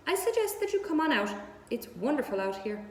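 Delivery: a goose tempting him outside. Acted. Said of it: "who talked rather fast".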